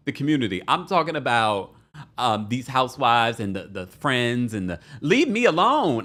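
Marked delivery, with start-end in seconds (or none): 5.0-6.1 higher, slow and emphatic; exasperated plea. Real, not acted. Host to the housewives and friends, exasperated plea. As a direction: draw it out, raised in pitch.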